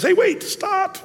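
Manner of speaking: a bear pleading suddenly; talking bear. Retold or imitated.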